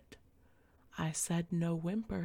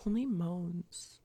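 ordinary talk, nothing marked

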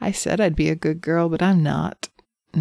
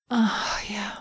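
breathy whisper; 3 semitones higher, 6 dB quieter than usual. Acted. Aroused roleplay.

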